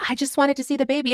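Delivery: high, fast, gushing stranger imitation; nosy stranger. Stranger peeking into the car to see the baby, high and fast. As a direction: mimic the intrusive fan cooing at the baby. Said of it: "she sticks her head in and she's like".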